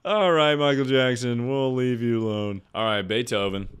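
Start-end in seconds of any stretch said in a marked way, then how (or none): 0.0-2.6 loud wrap-up. Real, not acted. Loud dismissal.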